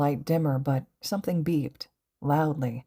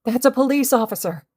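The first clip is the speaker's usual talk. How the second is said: higher, louder relieved cry; cried in relief; 9 semitones higher, 7 dB louder, faster than usual. A narrator's reading. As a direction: call out in sudden relief. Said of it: "Kendo cried in relief".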